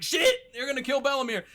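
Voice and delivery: high, loud alarmed yelp; hammed-up panic for someone in danger; mock alarm. Acting the girls' alarmed reaction.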